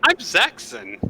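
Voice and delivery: high-pitched character voice; small fellow's high introduction; scrawny little rogue. Acted. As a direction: pipe up with your name. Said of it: "And he says".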